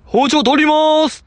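high, loud drawn-out call imitating an idol; belly-deep shout. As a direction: call it out from the belly, last vowel held long. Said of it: "腹から声出たんですよ".

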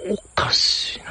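whispered puzzled aside; muttering to self. Real, not acted. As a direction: whisper it softly to yourself. Puzzled aside when nobody comes. (ひそひそ声の独り言)

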